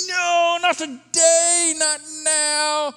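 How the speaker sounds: high, loud protest at the traffic; frustrated shout. Retold or imitated. Driver fuming at the traffic jam, shouting no. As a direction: cry out no at the detour.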